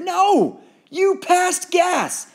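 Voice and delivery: high, loud, slow; jeering taunt. Acted. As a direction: drag out the insult. Bully taunting.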